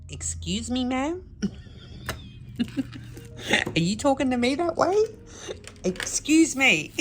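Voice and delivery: high indignant "excuse me, ma'am?"; offended retort. Retold or imitated. Sales assistant taking offence at the shout, indignant. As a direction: play the affronted shop assistant. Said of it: "looked up at me as if to say".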